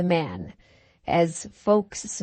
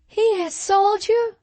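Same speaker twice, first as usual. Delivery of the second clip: very high, slow questioning voice; Katrina's voice; 13 semitones higher than usual. A narrator's reading. Katrina asks Alan about the betrayal, high and slow. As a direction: ask it slowly in a high woman's voice.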